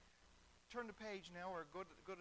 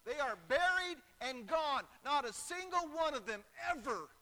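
loud, hammering pulpit delivery; full-force preaching; 4 semitones higher, 12 dB louder than usual. Real, not acted. Preacher tells congregation false prophets are buried, forceful. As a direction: drive each point home loudly.

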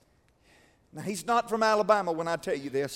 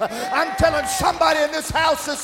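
loud preacher's plea, climbing higher as it builds; impassioned preaching cry; 5 semitones higher, 8 dB louder than usual. Acted. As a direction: cry out to God at full pulpit force.

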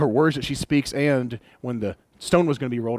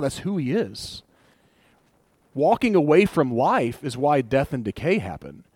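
ordinary talk

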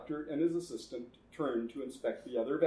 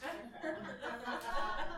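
high villain cackle; 10 semitones higher, 8 dB quieter than usual. Acted. Laugh after running out.

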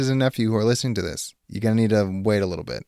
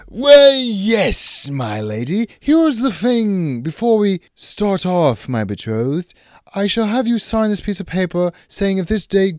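medieval suitor; 3 semitones higher than usual. Acted. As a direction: play a grand medieval lord. Partner switches into suitor role.

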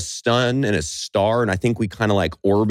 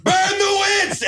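angry mob leader. Retold or imitated. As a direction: yell to rouse the crowd. Townspeople at witch burning.

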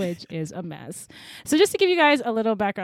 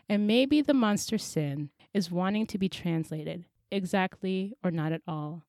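poem reading; 2 semitones lower than usual. Acted. Reading her poem to the podcast listeners, measured and intense.

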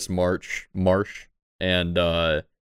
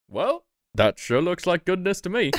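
NPC voice; 11 semitones higher than usual. Acted. NPC voice, raised pitch.

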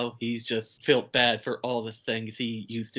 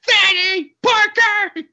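long, drawn-out yell, much higher and louder; imitated yell; 11 dB louder, slower than usual. Dave kicked out yelling after her, a drawn-out hammed-up yell. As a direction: call it out desperately, slow. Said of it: "he's yelling".

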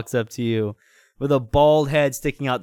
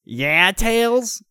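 attempted cartoon hero voice, pitched up; higher character voice, not fully convincing; Sonic; 7 semitones higher than usual. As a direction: put on an amateur high cartoon voice. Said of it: "I can't do a good Sonic voice".

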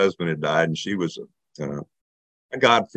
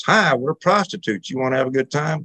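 parrot voice; 7 semitones higher than usual. Retold or imitated. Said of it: "first words out of her bird's mouth".

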